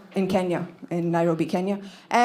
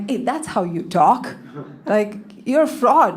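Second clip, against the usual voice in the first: put-on British accent; slower than usual. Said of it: "a bunch of British exchange students".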